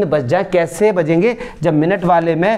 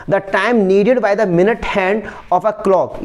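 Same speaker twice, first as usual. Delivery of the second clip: slow reading; much slower than usual. Shown as a demonstration. Teacher reads question text aloud.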